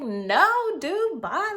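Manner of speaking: high, loud, dismissive brush-off; curt brush-off. Retold or imitated. Turning down the pushy guy's number, high dismissive brush-off. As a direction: shut him down and leave.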